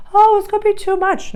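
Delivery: high, loud whiny mock-despair; mock whiny quitter. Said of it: "you're just gonna lay down and be like".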